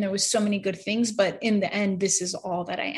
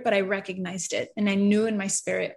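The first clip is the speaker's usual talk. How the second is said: ordinary talk, nothing marked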